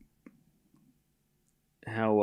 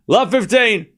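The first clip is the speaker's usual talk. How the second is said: "Love fifteen" called out higher and louder; announcer score call; 8 semitones higher, 4 dB louder, slower than usual. Real, not acted. Calling the score for listeners, a clear umpire call. Calls score again properly.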